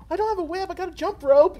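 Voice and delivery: put-on kid's voice. Kid at the hat giveaway, high childish voice. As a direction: play the kid pleading his case. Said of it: "And he's like".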